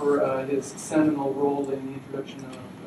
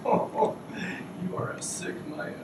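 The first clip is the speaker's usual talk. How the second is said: hushed mock disgust, breathy whisper; whispered jailer; 4 semitones higher, 4 dB quieter than usual. Jailer whispers to the prisoner, hushed mock disgust. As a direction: whisper it like you can't believe him.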